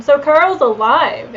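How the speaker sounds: normal talking voice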